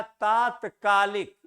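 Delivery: तात्कालिक with a long first vowel, taa-tkaalik, said slowly; correct pronunciation. Shown as a demonstration. Teacher correcting students' mispronunciation, slow and deliberate. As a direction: stretch the opening aa of तात्कालिक carefully. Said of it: "सही pronunciation करेंगे".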